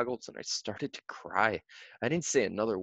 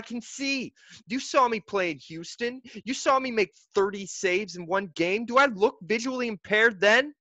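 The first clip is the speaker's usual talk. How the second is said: angry accusation; 11 semitones higher, 3 dB louder than usual. A narrator's reading. Boy accuses his mother.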